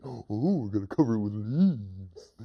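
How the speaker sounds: silly hunter. Acted. Silly hunter copying the leaves plan, goofy voice. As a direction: parrot it dopily. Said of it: "He repeats everything his friend says".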